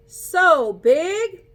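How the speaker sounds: loud, high, gushing; wowed storyteller cry; excited exclaim. Acted. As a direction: make it sound enormous. Showing the huge elephant.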